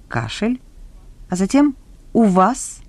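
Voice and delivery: ordinary delivery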